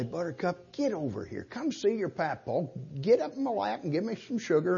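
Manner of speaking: soft, playful coaxing of a small grandchild; teasing, playful. Shown as a demonstration. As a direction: coax her over softly, teasing. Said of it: "in a manner that's kind of teasing and playing".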